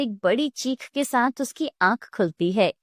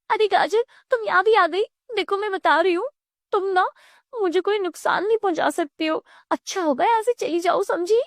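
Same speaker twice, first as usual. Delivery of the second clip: high-pitched, panicky warning; agitated crying out; 8 semitones higher, faster than usual. Acted. Savita warns mother-in-law she mistakes for carrot, high and agitated. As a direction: warn off a threat in a high voice. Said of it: "Savita का चिल्लाना बंद नहीं".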